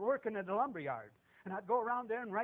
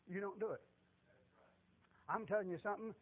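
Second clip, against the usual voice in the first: ordinary talk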